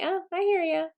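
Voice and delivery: high, light, easy acknowledgment; gentle brush-off. Retold or imitated. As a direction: pat the inner critic on the head and move on. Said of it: "we're just gonna say".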